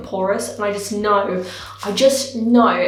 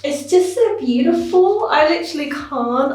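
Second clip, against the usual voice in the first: gushing delight; 6 semitones higher than usual. Real, not acted. Admiring the finished colour.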